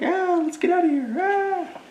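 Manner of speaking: very high, fast, panicked cry; the little animals panicking as the bear wakes; scared escape. Acted. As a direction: squeal and scramble to run away. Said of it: "They're like".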